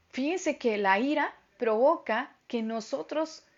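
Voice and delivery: ordinary talk, nothing marked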